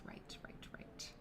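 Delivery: soft near-whisper; murmured to herself. Real, not acted. Working out the Spanish word, whispered to herself. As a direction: mutter it under your breath.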